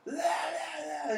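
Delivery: hammed-up drunk howling at the bar; drunk singsong. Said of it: "he's just going".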